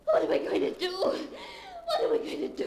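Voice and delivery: desperate, near tears; panicked. Acted. Trapped with Leonard, she asks in rising panic.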